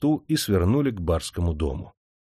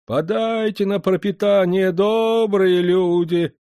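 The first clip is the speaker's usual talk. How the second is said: put-on old blind beggar's plea, high and slow; drawn-out begging; 10 semitones higher, 3 dB louder, slower than usual. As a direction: stretch the beggar's cry out long and high. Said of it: "протяжно запросил он". (протяжный, высокий голос нищего)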